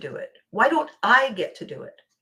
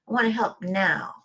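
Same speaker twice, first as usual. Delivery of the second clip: ordinary talk, nothing marked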